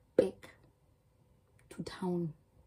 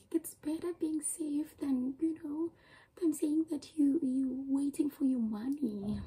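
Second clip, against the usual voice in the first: higher, whispered parental warning; imitating parents' caution; parents' advice; 7 semitones higher than usual. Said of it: "our parents are like".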